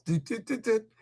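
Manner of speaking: high, soft little dialling noises; quick mouth-made beeps; imitated dialling beeps. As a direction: mimic phone keys being pressed.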